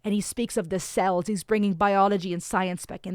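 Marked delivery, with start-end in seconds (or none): none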